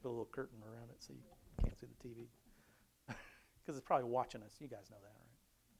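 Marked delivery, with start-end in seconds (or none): 4.6-5.8 softer, then a breathy whispered right; conspiratorial whisper. Pretend. Preacher teasing his listeners about the TV, mock-secret whisper. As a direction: lean in and whisper the joke.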